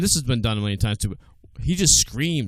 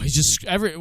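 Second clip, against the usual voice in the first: ordinary talk, nothing marked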